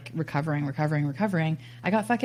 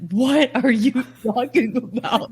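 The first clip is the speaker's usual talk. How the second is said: higher, louder, slow disbelief breaking into a laugh; incredulous laughing question; baffled laugh; 8 semitones higher, 6 dB louder, slower than usual. Retold or imitated. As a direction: laugh at the nonsense advice. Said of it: "And I was like".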